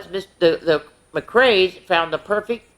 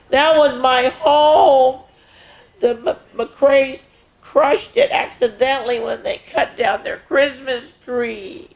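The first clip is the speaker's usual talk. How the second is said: sad; 8 semitones higher, slower than usual. Acted. Sad skunk tells of crushed home.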